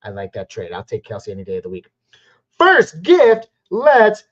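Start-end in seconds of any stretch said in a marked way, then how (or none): 2.5-4.2 high, loud, drawn-out cheer; hyped streamer's shout; excited shout. Real, not acted. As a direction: yell it out, stretching the last word. Celebrating a viewer's gift.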